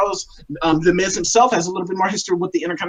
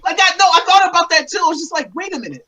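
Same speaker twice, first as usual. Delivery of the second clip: excited realization; 9 semitones higher than usual. Retold or imitated. Excited agreement, retold thought.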